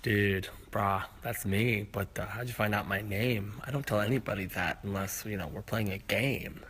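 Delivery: laid-back stoner, low and soft. Acted. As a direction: mumble it like a stoned buddy. Stoner Lou answers.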